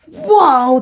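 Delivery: high, loud startled "whoa"; surprised whoa. Real, not acted. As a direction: pull yourself up short. Catching herself mid-sentence.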